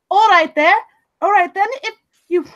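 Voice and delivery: high, loud British accent impression; Helena Bonham Carter. Shown as a demonstration. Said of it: "a little bit of a Helena Bonham Carter".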